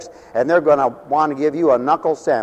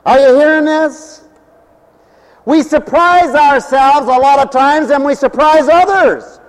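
higher, louder preaching voice; 12 semitones higher, 8 dB louder than usual. Acted. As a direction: rouse the congregation. Preacher exhorting congregation.

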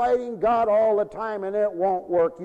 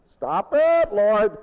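mock plea; 2 semitones higher, 5 dB louder, much slower than usual. Preacher crying out to the Lord, mock plea. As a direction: cry out to God to stop. Reacting to the Lord's heavy hand.

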